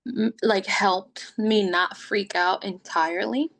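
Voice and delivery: ordinary talk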